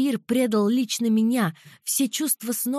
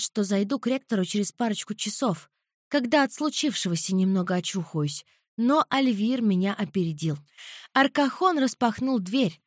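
usual speaking voice

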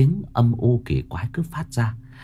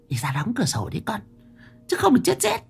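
put-on high, softer old woman urging; hag voice, hushed; 12 semitones higher, 4 dB quieter than usual. Mother Mão urging her daughter to shut the window, hushed hag voice.

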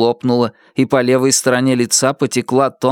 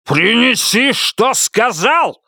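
higher, slow and furious; a husband screaming at his wife; shouting order; 10 semitones higher, slower than usual. A narrator's reading. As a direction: yell it at her. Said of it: "закричал он".

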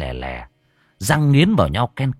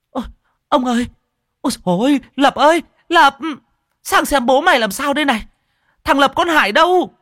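high-pitched panicked screaming for help; gào thét hoảng hốt; 4 dB louder, faster than usual. A narrator's reading. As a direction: cry out in alarm, high and urgent. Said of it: "Nghe thấy mẹ gào thét". (gào thét hốt hoảng, giọng cao vút)

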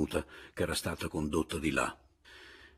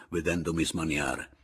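usual speaking voice

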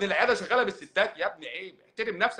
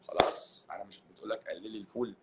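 a hushed conspiratorial aside, lower; mock secret whisper; 7 semitones lower, 8 dB quieter than usual. Doctor whispering a secret tip to the audience, hushed.

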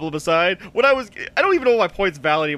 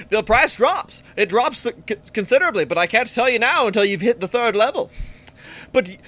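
put-on higher, old-timey pitchman patter; fast-talking old-timey voice; 3 semitones higher than usual. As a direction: sell it like a carnival huckster. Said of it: "fast-talking, uh, old-timey voice".

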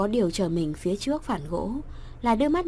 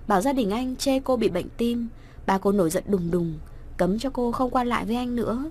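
ordinary talk, nothing marked

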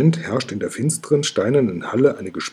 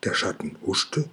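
whispered question; 3 semitones lower, 7 dB quieter than usual. A narrator's reading. Oskar asks in the dark hall.

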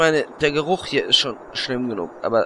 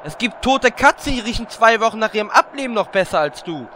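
insulting; 8 semitones higher, 4 dB louder than usual. Acted. Bickering insults between party members.

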